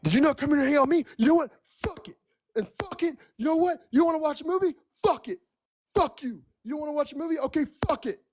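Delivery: imitating the boyfriend's petulant outburst; mock tantrum. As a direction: throw a hammed-up hissy fit. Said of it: "And he was like".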